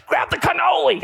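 high, loud mock Italian mother; Italian mom barking orders about the cannoli. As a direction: squawk it like a bossy nonna. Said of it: "She's like".

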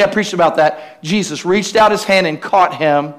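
ordinary delivery

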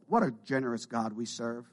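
ordinary talk, nothing marked

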